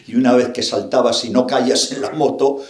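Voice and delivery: ordinary talk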